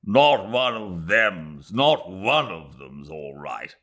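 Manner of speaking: put-on slow, emphatic old major; gruff contradiction. Major Callender contradicting Ronnie, slow and emphatic. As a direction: bluntly overrule him. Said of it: "contradicted Major Callender".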